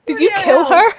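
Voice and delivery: high, loud shocked question. Real, not acted. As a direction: blurt it out in alarm. Shocked player reaction.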